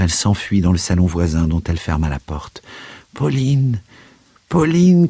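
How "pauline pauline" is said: high-pitched call, building louder; desperate cry. A narrator's reading. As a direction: call after her as she flees. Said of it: "cria le moribond en courant après elle".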